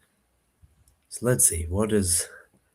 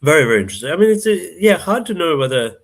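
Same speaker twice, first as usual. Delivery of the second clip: excited; 9 semitones higher, 8 dB louder than usual. Real, not acted. Commentator excited by game.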